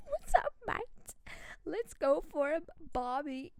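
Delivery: playful put-on Australian accent; Aussie accent. Shown as a demonstration. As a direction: ham up the Aussie slang, on the edge of laughing. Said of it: "for this Aussie accent".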